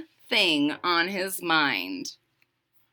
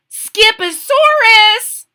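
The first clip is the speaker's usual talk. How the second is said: high, loud, drawn-out exclamation; loud declaration; 12 semitones higher, 11 dB louder, slower than usual. A narrator's reading. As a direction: declare it to the whole room. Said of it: "declared Mama Junebug Jones".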